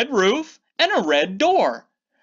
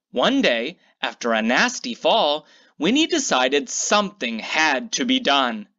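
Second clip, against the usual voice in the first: usual speaking voice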